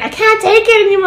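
hammed-up childish complaint; playful overdramatic wail; Gene-like whine. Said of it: "You said something like".

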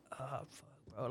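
breathy whisper, barely voiced; whispered groan. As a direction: mumble it like you're half asleep. Cousin half asleep, unvoiced.

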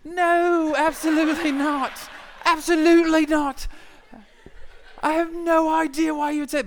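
hammed-up British outrage, high and loud; British girlfriend. British girlfriend reassuring her insecure friend, over-the-top. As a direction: protest in a shrieky mock British voice. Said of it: "I don't know why she's British".

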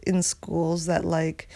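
ordinary talk

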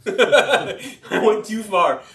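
laughing through the words, slow and loud; chuckling at his own clumsiness, drawn out. Real, not acted. Laughing as he tells the congregation about falling.

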